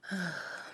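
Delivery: long heavy sigh before reading; big sigh. Real, not acted. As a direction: let out a big weary sigh. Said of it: "That's a big sigh there".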